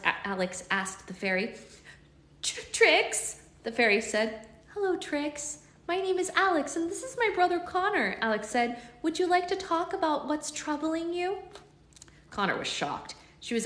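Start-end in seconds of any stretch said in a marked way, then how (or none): 2.4-3.4 tiny fairy voice. Acted. Fairy gives name. 4.7-11.4 gentle and kind. Acted. Alex gently soothing fairy.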